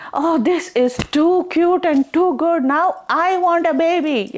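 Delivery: high, gushing excitement; gushing delight. Acted. Parvati watching the foal, gushing high with delight. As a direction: melt over the cute foal.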